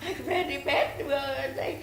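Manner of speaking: high, tearful voice; on the edge of sobbing. Real, not acted. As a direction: struggle to speak through tears. Still tearful after breaking down.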